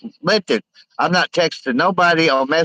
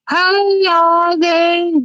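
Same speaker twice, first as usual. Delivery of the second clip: very high, slow drawn-out silly call; silly clown; 3 dB louder, much slower than usual. Acted. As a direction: stretch it way up like a goofy clown. Calling out to girls in car.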